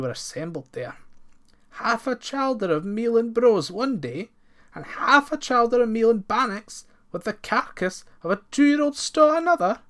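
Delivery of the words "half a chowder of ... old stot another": high, loud horrified repeat. A narrator's reading. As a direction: gasp it out in horror. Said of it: "they repeated in horror".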